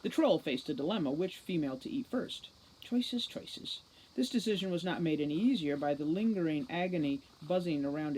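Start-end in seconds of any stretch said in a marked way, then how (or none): 2.8-3.8 whispered, gloating choices; sly musing. Acted. Troll musing over which girl to eat first, whispered. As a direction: savour the choice like a hungry beast.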